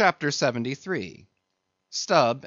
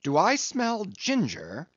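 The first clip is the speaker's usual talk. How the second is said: high, drawn-out suspicious question; raised pitch, slow and wary; 8 semitones higher than usual. A narrator's reading. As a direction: sniff out something fishy. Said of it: "suspiciously asked Stubb".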